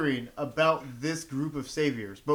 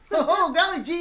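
high, laughing golly-gee; aw-shucks voice; 14 semitones higher, 4 dB louder than usual. Pretend. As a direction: gush like a wide-eyed farm boy. Mock folksy reaction, laughing.

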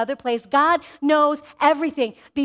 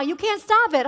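ordinary delivery

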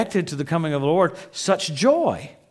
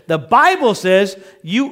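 ordinary delivery

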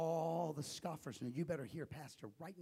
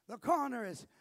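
usual speaking voice

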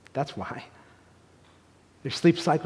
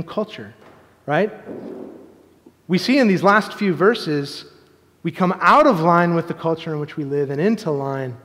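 raised preaching; 3 semitones higher, 6 dB louder than usual. Real, not acted. Pastor reading Jesus' words to the congregation, raised preaching. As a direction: read the scripture out and press the conclusion.

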